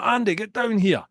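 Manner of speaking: high urgent call up the stairs. Retold or imitated. Bouncer calling his mate down the stairs, urgent call. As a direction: summon your mate up top. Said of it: "I've gone".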